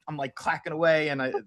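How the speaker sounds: ordinary talk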